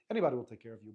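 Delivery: usual speaking voice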